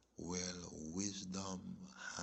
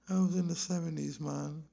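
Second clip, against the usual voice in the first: laughing; 10 semitones higher, 7 dB louder than usual. Real, not acted. Laughing at an old memory.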